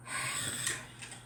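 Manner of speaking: long unvoiced sigh of disbelief; exasperated sigh. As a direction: let out a breathy sigh at the absurdity. After paramedic reveals himself.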